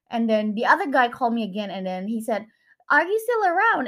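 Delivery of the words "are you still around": a much higher, louder imitation of the man on the phone; eager high voice. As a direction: pitch the caller's question up high and eager. Retells the man calling back.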